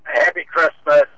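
ordinary delivery

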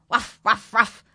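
loud, high mock dog bark. The frightened dog barks again in the tree. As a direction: woof out like a scared dog.

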